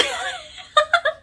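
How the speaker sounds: sudden high laugh; high burst of laughter. Real, not acted. Vlogger laughing at her own phrase, high laugh.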